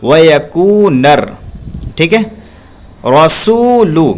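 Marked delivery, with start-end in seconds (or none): 0.0-1.4 stretched, drawn-out वयकू नर recited; slow Quran reading. Shown as a demonstration. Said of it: "पढ़ेंगे". 3.1-4.1 रसूलु with a thick, full रा, slowly; heavy ra recited. Shown as a demonstration. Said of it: "रा पे ज़बर है".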